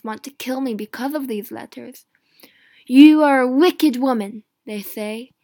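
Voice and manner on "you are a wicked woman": furious shout of an accusation; accusers shouting. Acted. Mary's accusers condemning her, in a furious shout. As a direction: hurl it at her, much louder. Lords accusing Mary of treason.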